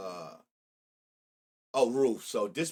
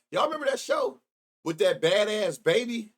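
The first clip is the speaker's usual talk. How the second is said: excited question; 7 semitones higher, 3 dB louder than usual. Real, not acted. Excited asking chat about show.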